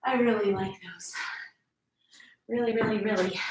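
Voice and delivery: whispered and out of breath after the set; breathless whisper. Real, not acted. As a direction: gasp the words out, barely voiced.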